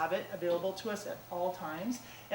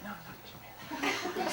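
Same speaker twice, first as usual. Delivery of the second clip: quiet refusal; 5 semitones higher, 7 dB quieter, much faster than usual. Retold or imitated. Arguing with herself about her hair, soft murmur. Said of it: "And I was like".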